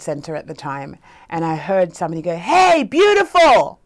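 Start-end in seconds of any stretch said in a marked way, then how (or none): 2.4-3.8 slow, high, loud catcall; calling out. Retold or imitated. Man hailing her in the World Trade Center, loud drawn-out call. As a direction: holler it drawn out to someone far off. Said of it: "like that".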